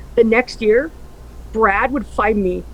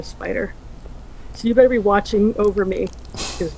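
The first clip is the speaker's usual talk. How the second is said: tearful farewell; 4 semitones lower, 6 dB quieter than usual. Real, not acted. Tearful goodbye to late friend.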